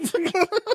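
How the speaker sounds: real high laugh; short squeaky laugh. Real, not acted.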